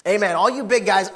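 usual speaking voice

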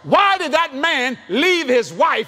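rising preaching. Acted.

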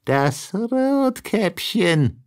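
put-on wolf faking a high, slow little girl's voice; wolf pretends to be the girl at the door; disguised voice. A narrator's reading. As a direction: pitch it high, slow and innocent like a child. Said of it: "rief der Wolf mit verstellter Stimme".

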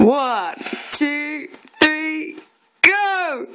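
rising countdown ending in a shout; excited countdown. Real, not acted. Counting down the challenge.

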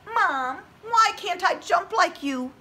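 high, pleading little tadpole. Acted. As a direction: plead like a tiny child. Tadpole asks mother.

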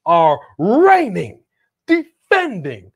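booming, stretched fight-night introduction, pitched high; hammed-up wrestling announcer building to a long high peak; ring announcer. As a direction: announce a champion, dragging out each title.

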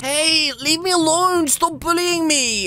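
high, slow, drawn-out plea; hammed-up victim's complaint; mock protest. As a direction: whine each word out at a bully. Protests at bully in game.

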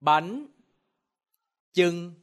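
"Bánh chưng" spaced, rising bánh, level chưng; Vietnamese pronunciation. Shown as a demonstration. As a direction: model "Bánh chưng" slowly. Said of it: "now repeat after me".

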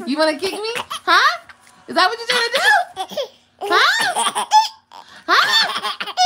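high, loud playful telling-off; mock scolding. Mama play-scolding her baby for kicking her, mock outrage.

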